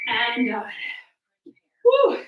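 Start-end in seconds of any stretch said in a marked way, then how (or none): 1.8-2.2 high, loud whoop at the finish; relieved woo. Real, not acted. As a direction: celebrate the end of the set. Finishing the round.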